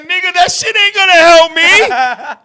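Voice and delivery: high, loud, incredulous yelling; shouted protest. Retold or imitated. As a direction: retell it as a panicked, shouted objection. Retelling his protest to the wrestler.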